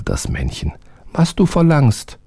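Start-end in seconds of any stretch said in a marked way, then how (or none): 1.1-2.2 high, small servant voice; eager little servant, high. A narrator's reading. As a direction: pitch up for the tiny obedient helper. Narrator voices the little man.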